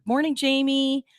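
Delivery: ordinary delivery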